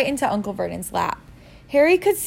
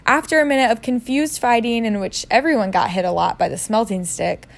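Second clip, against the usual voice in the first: plain reading voice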